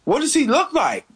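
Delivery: pitched far up, suspicious; retold high-voiced probing question. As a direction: squeak it out as suspicion dawns. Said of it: "And I was like".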